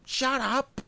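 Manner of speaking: high, sharp mock outburst; exasperated shut up. Fed-up worker yelling at bosses, sharp and high. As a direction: snap shut up at the office jargon.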